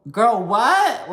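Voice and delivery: high, loud burst of disbelief; incredulous. Retold or imitated. Host reacts to a castmate's so-called compliment, incredulous. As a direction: throw it out high, utterly baffled.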